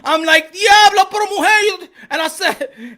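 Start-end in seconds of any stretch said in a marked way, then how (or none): 0.5-1.9 loud, high Spanish outburst; re-enacted startled shout; mock shocked exclamation. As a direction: exclaim in disbelief at the attack. His shocked reaction to being attacked.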